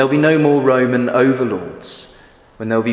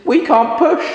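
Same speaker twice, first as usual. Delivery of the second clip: very high clucking chickens; 3 dB louder than usual. Acted. Chickens refusing to push, very high and squawky. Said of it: "say the chickens".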